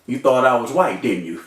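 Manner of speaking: low, dry retold tease; wry joke. Shot man teases the cop, wry joke. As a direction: drop low to tease the officer. Wry line to officer, pitched down.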